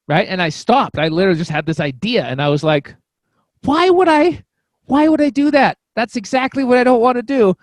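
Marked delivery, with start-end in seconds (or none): 3.6-7.5 loud, high-pitched mock objection; incredulous protest. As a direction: push back hard, as if appalled. Retells arguing with inner intuition.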